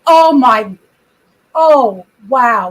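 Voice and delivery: loud astonished exclamations; genuinely amazed outburst; surprised. Real, not acted. Bear photo at front door.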